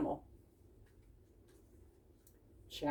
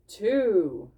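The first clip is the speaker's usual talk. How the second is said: louder count aloud; 6 dB louder than usual. Acted. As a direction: call the number out. Tobin counting out loud.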